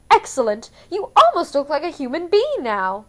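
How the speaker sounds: a fussy stylist delighted with his work; Capitol accent. A narrator's reading. Flavius praises the cleaned-up Katniss, gushing Capitol accent.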